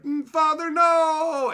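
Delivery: put-on high, loud child's cry of anguish; a desperate kid shrieking for his father. Child throwing himself in front of the blow, crying out. Child jumps in to save parent.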